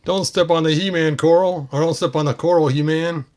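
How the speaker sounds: high, loud character shout; toy figure voice. Pretend. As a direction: bark a warning as the toy.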